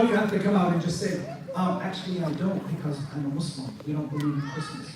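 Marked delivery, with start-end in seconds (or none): 1.6-4.9 low, quiet, matter-of-fact assurance; softer, lower-pitched plain statement of belief; confident. Shown as a demonstration. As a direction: state it calmly and directly, no hedging. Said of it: "You say that confidently".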